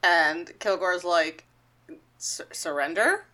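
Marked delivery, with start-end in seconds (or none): 2.2-3.2 soft, slow, questioning stammer; small uncertain mock reply; hesitant catchphrase. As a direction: offer it timidly, as a question. Pest answers sheepishly with catchphrase.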